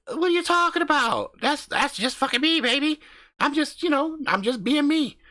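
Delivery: brash country husband hyping up his channel; cocky backwoods YouTuber swaggering through the intro; crude hillbilly husband. Acted. Husband defends his swearing.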